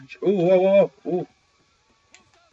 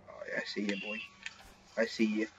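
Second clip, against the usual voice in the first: softer, hushed stalking whisper; sneaky whisper; 4 semitones lower, 14 dB quieter than usual. Real, not acted. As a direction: whisper like you're creeping up on prey. Sneaking up on enemy player.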